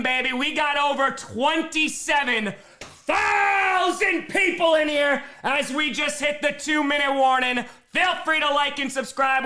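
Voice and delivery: high, excited shouting easing slightly; rally the viewers while still fired up from the play; hyped-up pitch. Real, not acted. Hyped plea after big first down.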